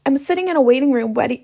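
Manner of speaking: normal talking voice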